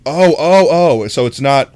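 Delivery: ordinary delivery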